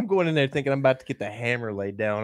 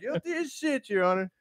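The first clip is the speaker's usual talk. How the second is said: much higher, belting it out; proud loud plea; 11 semitones higher than usual. Retold or imitated. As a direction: belt it like Dewey Cox. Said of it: "He was Dewey Coxing that shit in there".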